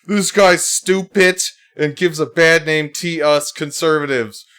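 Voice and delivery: indignant mock tweet reading, loud and high; mock outraged reply. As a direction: play a huffy self-righteous poster. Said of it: "And then I said".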